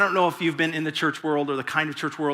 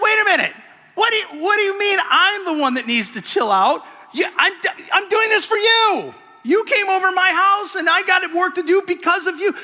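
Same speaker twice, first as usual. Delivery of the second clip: high, loud exasperated protest; indignant Martha; 14 semitones higher, 5 dB louder than usual. Retold or imitated. Martha protesting to Jesus, loud and exasperated. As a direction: push back hard, offended and overworked. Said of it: "So it's like".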